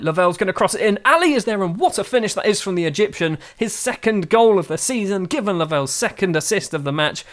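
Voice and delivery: commentary lifting into a goal call; excited goal commentary. Real, not acted. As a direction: call the finish with rising excitement.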